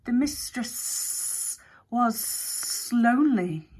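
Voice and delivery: slow, whispery drawn-out snake; a sly snake confessing at half speed; snake Hex, confiding. A narrator's reading. Snake Hex admitting the secret to Alex, slow and sly.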